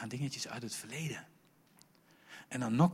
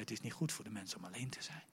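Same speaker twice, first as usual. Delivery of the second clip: whispered quote, fast; soft whispered Bible verse; quoting God; 3 semitones lower, 12 dB quieter, faster than usual. Retold or imitated. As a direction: hush it like a secret. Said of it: "dat God zei".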